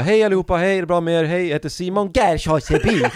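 high, loud show-host greeting with laughter; mock stage greeting. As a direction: greet the crowd like a cheesy host. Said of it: "Jag bara". (överdrivet scenhälsande)

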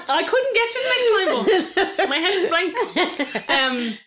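giggling through the words; laughing. Real, not acted. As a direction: laugh while admitting it. Laughing at forgetting the song.

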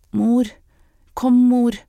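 put-on small child urging mother to come; child calling mother. A narrator's reading. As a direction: call out for mother in a high, insistent voice. Small frightened boy calls his mother.

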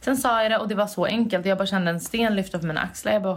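usual speaking voice

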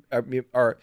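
ordinary delivery

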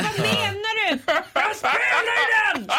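imitated shouting. DJ yelling back at the requester, imitated shout. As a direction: yell back like an exasperated DJ. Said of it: "skrek han".

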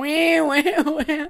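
high, loud siren wail; imitated alarm siren. Podcaster mimics a panic alarm for her friend, with a high, loud siren wail. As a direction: mimic a whooping alarm going off. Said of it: "Såna alarmcylindrar och bara".

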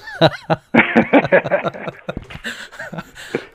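long high burst of real laughter. Real, not acted. Laughing at his own story.

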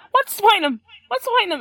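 indignant mock rant; angry dad. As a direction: imitate the dad ranting angrily. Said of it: "He was so angry".

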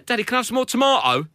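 put-on high child asking "tomato" posh; little daughter voice. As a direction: voice a small girl politely asking. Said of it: "she went".

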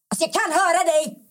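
high-pitched retold shriek, not full volume; mock indignant yell. Diana confronts a whispering stranger, mimicked indignant shriek. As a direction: imitate a friend shrieking in outrage. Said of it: "Jag skriker direkt".

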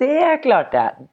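proud drawn-out boast; triumphant. Real, not acted. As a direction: savour the win slowly.

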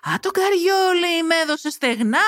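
high, drawn-out outraged gasp of words; indignant. Acted. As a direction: stretch it out in indignation. Heroine fumes inwardly at betrayal. (αγανακτισμένα, ψηλά και τραβηχτά)

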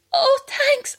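high, sweet and polite; high sweet thanks. Retold or imitated. Neighbour girls accepting the invite, high and sweet. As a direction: chirp a grateful little thanks.

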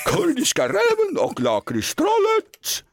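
a lightly put-on celebrity voice; guess-who impression, half-hearted. Host opens the impression day himself, lightly put-on voice. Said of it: "Jag kan starta upp med en här".

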